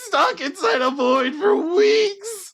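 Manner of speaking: very high, loud, drawn-out cry; panicked wail. Acted. People fallen out of empty space, panicked. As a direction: wail it in hammed-up hysterics.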